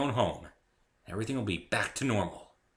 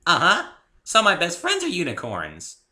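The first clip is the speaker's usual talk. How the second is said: higher, louder chirpy pony; proud pony; 10 semitones higher, 11 dB louder than usual. A narrator's reading. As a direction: boast happily like a pony. Pony bragging.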